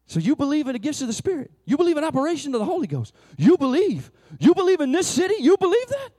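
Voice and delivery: imitating a friend's mounting thrill; dramatic excitement. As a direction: build to a giddy peak. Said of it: "he is that dramatic".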